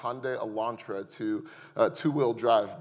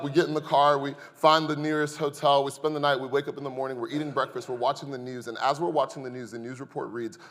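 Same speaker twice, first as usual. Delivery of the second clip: normal talking voice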